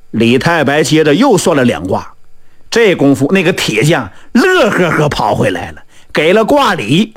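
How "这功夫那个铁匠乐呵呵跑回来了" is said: usual speaking voice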